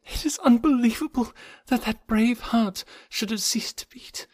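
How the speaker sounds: high, breathy, anguished lament; grief near sobbing. A narrator's reading. Jane mourning his death at sea, anguished, near collapse. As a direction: start very faint and breathy, swell into grief, break off. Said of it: "sank sobbing to the floor".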